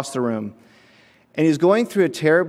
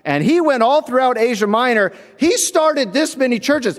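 bragging Corinthian; 6 semitones higher than usual. Retold or imitated. Corinthian bragging to a rival about Paul, higher and smug. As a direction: puff up your guy like a fan. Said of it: "we talk like these Corinthians".

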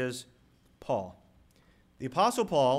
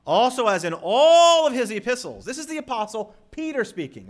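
raised, loud pulpit delivery; forceful preaching; 6 semitones higher, 8 dB louder than usual. Real, not acted. Preacher stresses that Peter vouches for Paul, forceful preaching. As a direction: ring it out to the whole congregation.

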